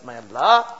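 ordinary talk

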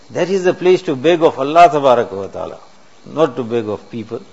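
loud, emphatic, rising to a peak on Allah; forceful preaching. Real, not acted. Preacher urging the congregation, forceful and loud.

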